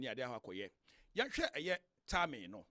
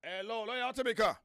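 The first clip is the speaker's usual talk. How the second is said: raised voice, checking the line; calling out; 7 semitones higher than usual. Real, not acted. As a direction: call out to a caller on the phone. Host calling guest on phone line.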